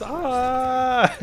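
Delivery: high, long held "ah" yell; mock shout. Player imitating all they would learn, random shouting. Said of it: "Just shouting, random shouting".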